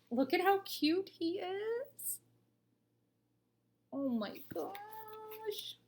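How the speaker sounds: high, slow cooing over a cute picture. Real, not acted. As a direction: melt over the drawing, drawn out.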